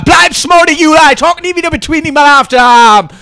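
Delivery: mock gangster shout; catchphrase shout. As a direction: bellow it like a silly rapper. Character yelling catchphrase.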